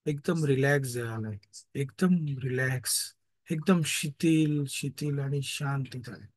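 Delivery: calm, slow relaxation guidance; guided meditation. Acted. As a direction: breathe them into calm. Leads a meditation.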